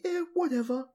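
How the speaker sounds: high, careless brush-off; dismissive. Retold or imitated. Tai brushing off his friends, high mock dismissive. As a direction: toss it off like a bored kid. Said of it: "He's like".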